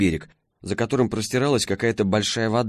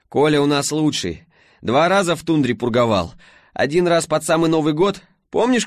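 перекрикивая мотор; 6 semitones higher, 3 dB louder than usual. A narrator's reading. Алёна кричит сквозь мотор.

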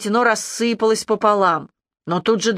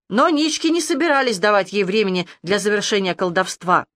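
plain reading voice

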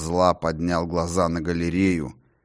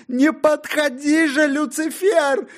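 higher, with a cry in the voice; crying plea; 5 dB louder than usual. Acted. John begs Lucifer again, crying. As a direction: beg through tears.

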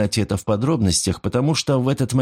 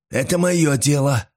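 higher, firmly commanding retort; imperious; 6 semitones higher than usual. A narrator's reading. Old man rebuffs Paul's question, suddenly imperious. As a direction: put him in his place. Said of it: "заявил Норман неожиданно властным тоном".

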